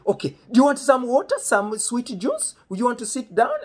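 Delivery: high, loud mock host; imitating hospitable dad. Friend's dad offering the guest a drink, high, loud imitation.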